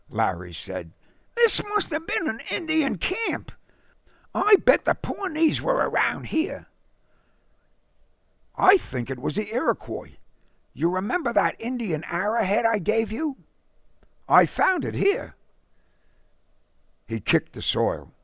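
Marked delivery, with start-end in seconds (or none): 1.4-6.7 put-on high, eager young explorer; boy character. A narrator's reading. 8.6-15.4 put-on raised boyish voice; a boy telling his friend, pitched up; boy character. A narrator's reading.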